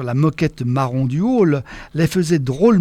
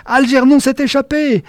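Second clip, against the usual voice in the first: high, louder shriek of alarm; sudden high alarmed cry; 8 semitones higher, 4 dB louder than usual. A narrator's reading. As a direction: sound the alarm. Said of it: "puis Berthe cria".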